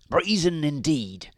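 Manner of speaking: lightly voiced storybook husband; Mister Grinling. A narrator's reading. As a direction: agree as an indignant husband. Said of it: "said Mister Grinling".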